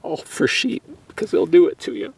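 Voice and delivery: teary voice breaking high; choked up after a long hunt; crying, worn out. Real, not acted. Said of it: "I can't stop crying".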